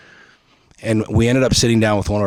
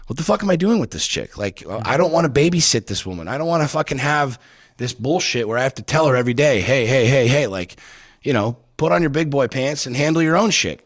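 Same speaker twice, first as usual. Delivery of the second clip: raised, ranting complaint; fed-up husband ranting about wife; angry diatribe; 3 semitones higher than usual. Retold or imitated. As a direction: vent it hard, voice up. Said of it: "entire diatribe".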